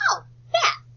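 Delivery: pleased discovery. Shown as a demonstration. Discovering the word.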